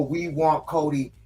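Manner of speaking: ordinary delivery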